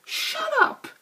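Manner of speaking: very high shouted snap; annoyed yell. Retold or imitated. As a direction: bark it back at the heckler. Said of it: "the guy playing goes".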